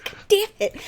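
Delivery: high-pitched curse through laughter; laughing exasperation. Real, not acted. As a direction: laugh while cursing at being upstaged. Laughing after being upstaged by co-host.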